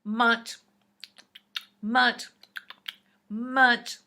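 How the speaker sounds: loud, punchy munch; loud munching sound. Acted. Reader acting out Alligator's loud munching. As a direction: chomp each munch out.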